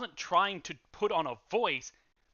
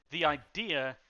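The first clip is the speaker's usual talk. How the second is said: ordinary talk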